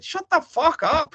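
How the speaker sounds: indignant shouted comeback; retorting shout. Retold or imitated. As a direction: fire it back at him, slow and loud. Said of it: "And I'm just like".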